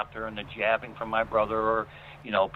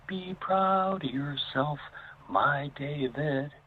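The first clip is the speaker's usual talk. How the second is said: ghostly grandmother; 5 semitones higher, much slower than usual. Retold or imitated. Imitating his late grandmother's voice on the recording, high and slow. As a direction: stretch it out like a voice from beyond.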